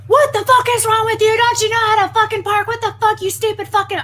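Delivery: hammed-up raging shriek; imitated screaming woman. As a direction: play the furious stranger yelling over a parking spot. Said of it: "cussing me out".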